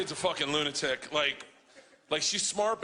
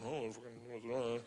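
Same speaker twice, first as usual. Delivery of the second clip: low, much softer self-talk; muttering to himself; 7 semitones lower, 11 dB quieter than usual. Retold or imitated. Caught doing the math in his head, muttering. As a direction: mumble under your breath, acting it out.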